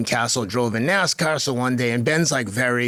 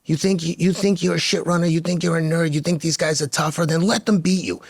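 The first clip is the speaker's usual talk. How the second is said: firm coach challenge without yelling, higher pitch; 5 semitones higher than usual. Retold or imitated. Coach challenging runners to believe they are tougher, firm. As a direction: dare them to prove themselves. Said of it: "I'd be talking to them firmly".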